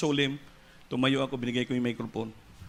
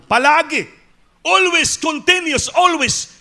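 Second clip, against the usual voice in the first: loud high cry of always; ringing pulpit shout; preaching shout; 12 dB louder, slower than usual. Real, not acted. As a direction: belt out the word always to the congregation. Preacher stressing God's constant provision.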